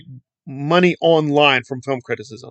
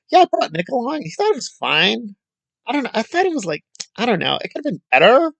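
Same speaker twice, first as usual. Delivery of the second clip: cutesy mock voice; 6 semitones higher than usual. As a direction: make it cute and vague, mocking her. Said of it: "She just makes it cute".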